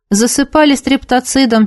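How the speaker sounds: plain reading voice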